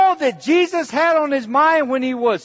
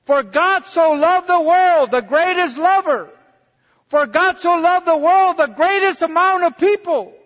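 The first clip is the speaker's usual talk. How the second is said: full-force preaching; 6 semitones higher, 4 dB louder than usual. Acted. Preacher proclaiming John 3:16 to the church, loud and high. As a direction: ring it out like the sermon's peak.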